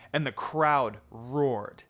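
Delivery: ordinary delivery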